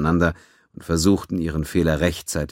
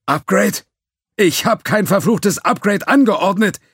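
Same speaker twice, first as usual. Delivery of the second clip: higher, louder furious shout; 6 dB louder than usual. A narrator's reading. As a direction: yell it at the team. Judge furious at technicians.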